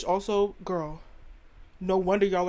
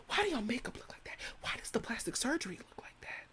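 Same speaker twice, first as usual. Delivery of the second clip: soft, high whisper of disbelief; whispered question; 3 semitones higher, 10 dB quieter than usual. Real, not acted. Comic judging British makeup and surgery, whispering. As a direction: whisper it like a scandalized aside.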